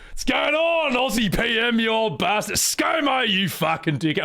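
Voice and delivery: high, boisterous Aussie phone banter; hammed-up Australian ribbing an old mate; Ocelot as an Australian. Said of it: "Ocelot's actually just Australian".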